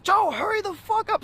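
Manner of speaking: high, strained retold shout; fed up, make him move; impatient yell. Urging friend to hurry.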